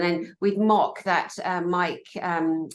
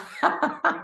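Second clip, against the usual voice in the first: airy high laugh; 11 semitones higher than usual. Real, not acted. Laughing at joke.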